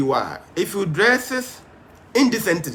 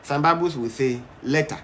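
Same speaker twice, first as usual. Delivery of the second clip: ordinary talk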